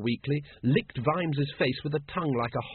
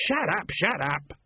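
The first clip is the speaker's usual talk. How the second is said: slow, pleading, lightly raised shut up; mild mock shout; 5 semitones higher, slower than usual. Colon cuts off the food talk, mild slow shout. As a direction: beg them to stop talking food. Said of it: "shouted Colon".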